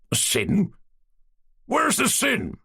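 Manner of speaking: indignant, rising high on the sin; old man insisting to the young cadet, emphatic. A narrator's reading. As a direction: push back hard on the question. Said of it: "said the old man emphatically".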